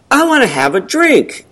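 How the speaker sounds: high, put-on commercial voice; radio ad woman. Woman in a whiskey radio commercial, put-on and high. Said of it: "I heard a radio commercial".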